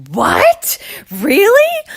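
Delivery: high, loud amazed "Really?" shooting up; amazed squeal. Retold or imitated. To her husband, hearing the big news: amazed high squeal. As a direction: squeal it out like you can't believe it.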